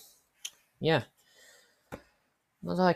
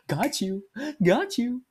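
high, loud gloating; teasing gloat; 13 semitones higher, 6 dB louder, slower than usual. Retold or imitated. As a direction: rub it in, way up high. Gloating over prank.

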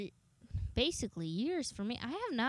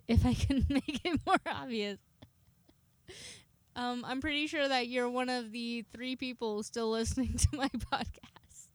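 giggling through the words; can't stop laughing while talking. Real, not acted. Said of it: "I'm laughing too much".

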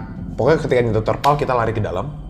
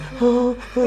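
high mock wailing sobs; imitated crying; 13 semitones higher than usual. Storyteller imitating the relative crying while asleep, high wail. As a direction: fake a high-pitched cry. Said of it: "Nangis beneran".

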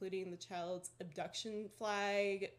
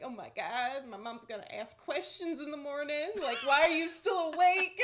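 panicked worry; 6 semitones higher, 9 dB louder than usual. Retold or imitated. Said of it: "I'm like".

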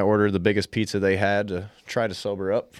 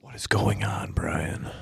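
breathy whisper to Brian; 5 dB quieter, slower than usual. Real, not acted. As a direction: whisper it, unvoiced. Mic test aside.

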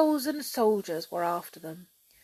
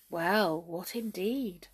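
usual speaking voice

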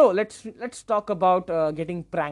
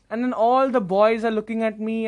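ordinary talk, nothing marked

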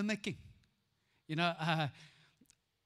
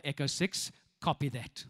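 much lower radio operator; radio call voice; 9 semitones lower than usual. Retold or imitated. Boy calling his farmer friend on radio. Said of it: "he would reply".

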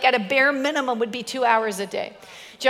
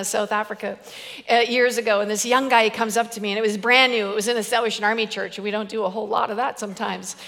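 ordinary talk, nothing marked

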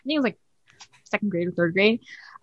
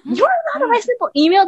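high-pitched retold outrage; mock-angry, much higher voice; imitating mad mom; 12 semitones higher than usual. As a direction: act out a mother's indignant disbelief. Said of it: "And she got so mad".

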